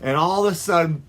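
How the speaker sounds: ordinary delivery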